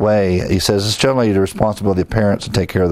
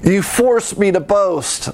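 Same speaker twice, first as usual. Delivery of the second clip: mock indignant [name]; 10 semitones higher, slower than usual. [name]'s mock complaint, after laugh.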